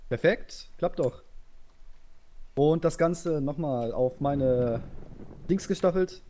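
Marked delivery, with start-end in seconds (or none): 5.5-6.2 calling orders to a line; barked drill commands. Real, not acted.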